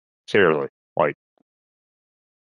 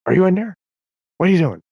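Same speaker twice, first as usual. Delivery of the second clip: high, louder creature calling; animal calling in; 12 semitones higher, 5 dB louder, faster than usual. Retold or imitated. Lynx outside his home, high loud calling voice. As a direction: call in like a curious lynx at the door.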